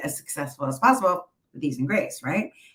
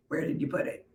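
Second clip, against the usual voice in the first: unvoiced baffled whisper; whispered reply; 9 dB quieter, faster than usual. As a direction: whisper it, puzzled. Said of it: "I'm like".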